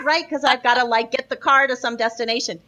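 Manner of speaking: normal talking voice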